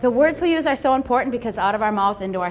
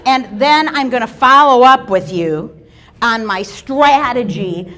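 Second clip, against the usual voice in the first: pushy salesman; 7 dB louder, slower than usual. Pretend. Mock pitch to Steve.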